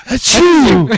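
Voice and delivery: loud high mock sneeze; fake sneeze. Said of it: "and be like".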